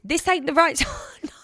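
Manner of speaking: amused brush-off; laughing retort. Retold or imitated. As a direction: laugh at his cheek. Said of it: "I said, This ain't the right time".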